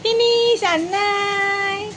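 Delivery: high, stretched sing-song. Real, not acted. Telling her pet the food is finished in slow high baby talk. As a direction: stretch it out slowly, cooing.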